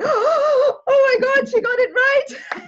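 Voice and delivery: very high, slow, squealing with delight; overjoyed squeal. Retold or imitated. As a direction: shriek it out in disbelieving joy.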